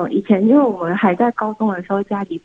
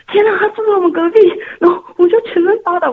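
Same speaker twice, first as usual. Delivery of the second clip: excited; 9 semitones higher, 4 dB louder, faster than usual. Retold or imitated. Retelling the thrill of a crush.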